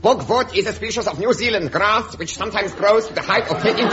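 hammed-up German accent, lightly done; ridiculous German accent. Human cannonball answering Captain Horn, mock German accent. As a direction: play a comic German strongman with a mild German accent. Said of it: "drop this ridiculous accent".

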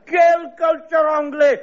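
high, shouted stage-French scoff; French accent, scornful shout; mock outrage. French official to interviewer, shouted scornful French.

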